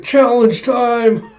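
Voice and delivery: loud, high, drawn-out announcement; acted battle cry. Retelling Oikawa calling to his partner, gleeful mock battle cry. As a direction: sing out a playful call to action.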